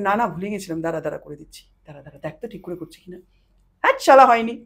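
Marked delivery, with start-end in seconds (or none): 0.0-3.2 hurried, much softer mock apology, faster; softly gabbled mock excuses to a scolding mother; flustered hurry. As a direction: rush the excuse out quietly, as if caught. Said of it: "আমি".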